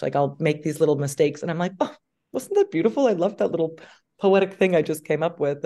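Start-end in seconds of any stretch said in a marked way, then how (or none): 1.8-5.6 mock self-admiring. Self-admiring quote of herself.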